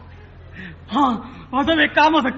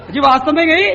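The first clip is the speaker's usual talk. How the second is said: ordinary delivery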